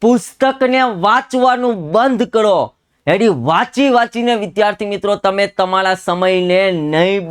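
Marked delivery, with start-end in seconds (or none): 0.0-2.5 higher, pressing on પુસ્તકને; insistent repeat. Shown as a demonstration. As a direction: hammer home પુસ્તકને with a lifted pitch. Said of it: "હજુ કહું છું".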